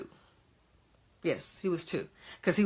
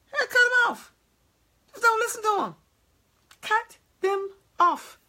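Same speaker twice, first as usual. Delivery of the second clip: very high-pitched, playful little voice; sing-song high pitch, lightly comic; 13 semitones higher than usual. Pretend. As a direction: jump way up high for cheeky dismissive advice. Mock advice on dismissing naysayers.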